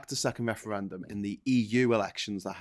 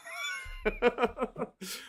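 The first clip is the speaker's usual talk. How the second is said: high-pitched, softer laugh; 7 dB quieter than usual. Real, not acted. Laughs after his own remark.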